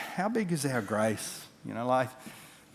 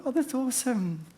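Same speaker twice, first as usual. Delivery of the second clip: cooing delight; 12 semitones higher than usual. Retold or imitated. Cooing over the baby.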